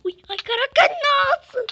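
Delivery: high shrieking panic; panicked scream. Real, not acted.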